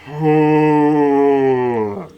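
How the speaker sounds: long weary sigh; heavy sigh. Real, not acted. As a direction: let out a heavy, reluctant sigh. Reluctant to call it demonic.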